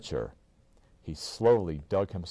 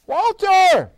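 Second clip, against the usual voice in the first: loud high call across the room; 13 dB louder than usual. A narrator's reading. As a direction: call his name loudly. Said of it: "he called out".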